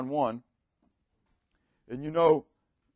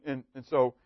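ordinary delivery

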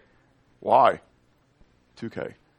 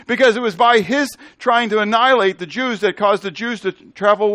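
raised, driving sermon delivery; forceful preaching; 6 semitones higher, 4 dB louder than usual. Real, not acted. Preacher arguing God's plan prevailed over Satan, preaching forcefully. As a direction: press each point on the congregation.

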